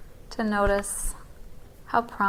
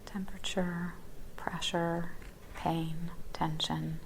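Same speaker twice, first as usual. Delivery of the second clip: breathy whisper, each word let go; whispered list; 8 dB quieter than usual. Real, not acted. Teacher naming sensations to students, whispering. Listing sensations in body scan.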